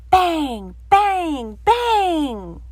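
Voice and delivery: loud, high bang sounds. A narrator's reading. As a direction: pound out each bang. Narrator voicing door banging.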